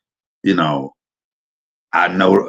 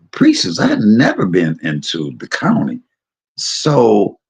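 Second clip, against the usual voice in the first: usual speaking voice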